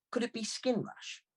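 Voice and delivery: put-on high young girl's voice; a touch of cheeky girl; impish girl. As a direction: toss out the guess lightly. Girl voiced in guessing game.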